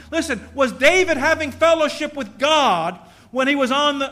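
loud, high fiery sermon build; preaching at full force. Acted. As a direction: drive the point home like a revival preacher. Preaching climax on David.

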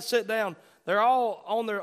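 usual speaking voice

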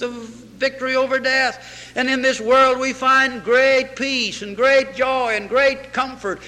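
ordinary delivery

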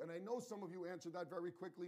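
ordinary talk, nothing marked

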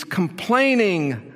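"Complaining" drawn out very slowly and stressed; emphatic first word. Shown as a demonstration. Preacher stressing the Greek word order, slow emphatic "Complaining". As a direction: lean hard on "Complaining", stretched out. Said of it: "the most emphatic word".

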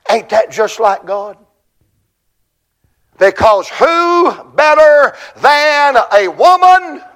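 ordinary talk, nothing marked

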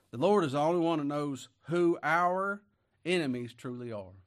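ordinary talk

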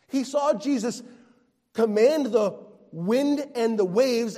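preacher listing miracles Thomas witnessed, building up; rising preaching fervor. Real, not acted. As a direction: climb toward the peak. Building sermon climax.

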